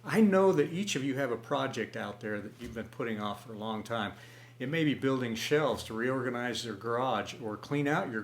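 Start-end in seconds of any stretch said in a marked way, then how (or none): none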